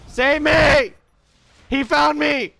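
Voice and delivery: high loud panicked yell for help; panicked cry. Real, not acted. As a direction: scream for rescue as he finds you. Panicked calling out in game.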